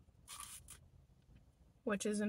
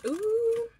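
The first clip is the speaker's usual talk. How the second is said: excited; 12 semitones higher, 7 dB louder than usual. Real, not acted. Reacting to tartar sauce.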